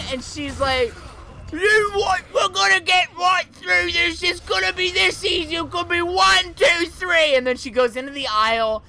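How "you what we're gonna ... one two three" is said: loud, high Cockney mom impression; heavy Cockney accent. Mom dragging her crying son through the store, heavy Cockney accent. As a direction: yell like an exasperated Cockney mother. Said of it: "the heaviest Cockney accent I've ever heard".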